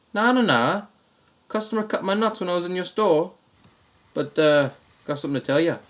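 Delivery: high-pitched Australian protest; Australian accent. Retold or imitated. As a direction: insist in a put-on Aussie voice. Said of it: "the same Australian accent".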